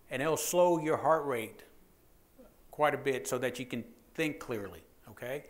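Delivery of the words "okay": ordinary delivery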